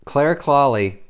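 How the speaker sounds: ordinary delivery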